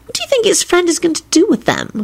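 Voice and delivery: high, playful character voice; impish. A narrator's reading. Woman quizzes partner about the corpses, impish. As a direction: ask mischievously in a high, light voice. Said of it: "asked with an impish expression".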